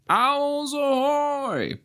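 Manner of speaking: very high, very slow call; high, drawn-out call; voice in the dark. A narrator's reading. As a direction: draw out a high greeting. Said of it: "came a voice".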